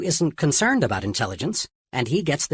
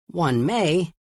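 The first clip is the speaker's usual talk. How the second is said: "may" lifted and held a touch; stress on may; 3 semitones higher than usual. Shown as a demonstration. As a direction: lean lightly on "may" as the key word. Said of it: "let me emphasize the word may".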